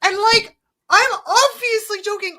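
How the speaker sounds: ordinary talk, nothing marked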